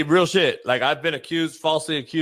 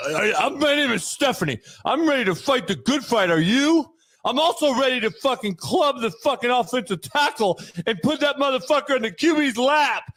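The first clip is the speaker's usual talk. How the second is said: heroic, booming rally cry; superhero-voiced Stephanie; 2 semitones higher than usual. Mimicking the defender as a hammed-up superhero. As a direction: pump up the team like a comic hero. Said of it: "Why you sound like a superhero?".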